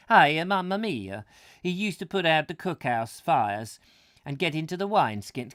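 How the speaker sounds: put-on loud, high comic Italian accent. Reader tries the Roman soldier as Italian, comic Italian accent. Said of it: "Romans speaking with an Italian accent".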